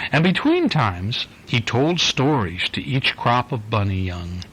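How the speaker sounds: gentle storybook read-aloud; reading to a child. Acted. Dad reading the Easter bunny book to his little girl, easy storytelling voice. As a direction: read it like a bedtime picture book for a little one.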